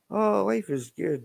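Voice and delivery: high, bright mock-happy squeal; swinging from gloom to mock delight; mock cheerful. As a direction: play up the sudden joy in a high voice. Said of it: "Then I'd be like".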